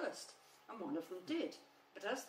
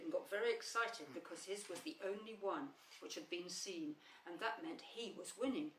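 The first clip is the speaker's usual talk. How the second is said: plain reading voice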